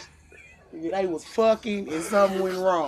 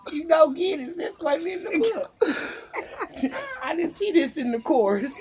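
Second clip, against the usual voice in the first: confused cop, laughing; 7 semitones higher, 3 dB louder than usual. Retold or imitated. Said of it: "they gonna be at the station like".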